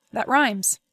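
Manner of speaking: ordinary delivery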